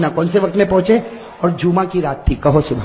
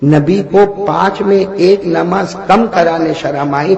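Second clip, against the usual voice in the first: normal talking voice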